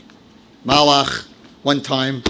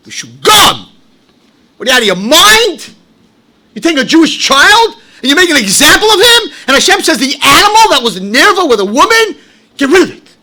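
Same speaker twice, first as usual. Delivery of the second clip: indignant; 11 semitones higher, 10 dB louder than usual. Real, not acted. Preacher's outrage at expelling kids.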